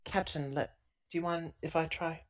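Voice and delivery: ordinary delivery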